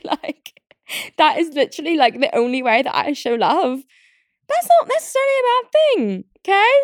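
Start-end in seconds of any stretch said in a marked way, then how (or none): 4.5-6.7 very high, squeaky protest; high-pitched, playfully defensive; defensive squeal. Real, not acted. Mock-defensive squeal about own habit.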